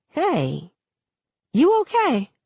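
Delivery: put-on girl's voice lifting high on the question; concerned friend. A narrator's reading. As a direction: check on a zoned-out friend. Kenya checks on dazed Starr.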